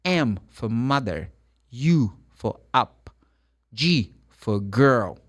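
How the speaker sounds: slow, measured spelling with example words; deliberate, spaced-out spelling. Shown as a demonstration. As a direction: answer a request to slow down, letter by letter. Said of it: "Can you speak slowly".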